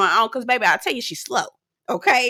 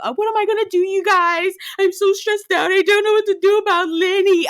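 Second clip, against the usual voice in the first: higher whiny complaining imitation of Lisa; mock whiny Lisa; 9 semitones higher than usual. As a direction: whine it like Lisa fretting over Lenny. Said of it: "Lisa ass is compl".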